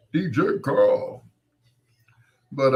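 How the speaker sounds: usual speaking voice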